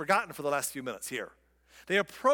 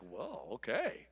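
stunned whoa; 6 semitones lower, 8 dB quieter, slower than usual. Retold or imitated. Jeremiah stunned the people agree.

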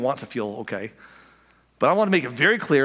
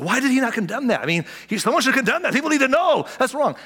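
mock outrage, high and louder; higher, louder indignant protest; indignant objector; 10 semitones higher, 3 dB louder than usual. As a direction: play the scandalised church critic. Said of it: "He said".

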